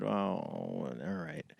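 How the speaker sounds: quiet, slow, sighing "oh, all right"; reluctant giving in. Retold or imitated. Pilot grudgingly agreeing to the Pentagon guy's request, reluctant and quiet. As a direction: give in grudgingly, barely above a murmur.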